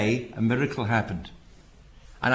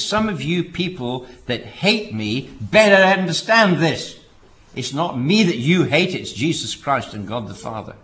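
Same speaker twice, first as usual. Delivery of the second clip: emphatic overdrive; 4 dB louder than usual. Retold or imitated. Preacher quoting Mister Armstrong's sermon in overdrive, loud and emphatic. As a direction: thunder it out in capital letters, then ease off. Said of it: "he goes into overdrive gear and all the capital letters start coming out".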